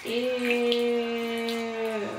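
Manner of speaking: ordinary talk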